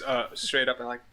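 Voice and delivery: ordinary delivery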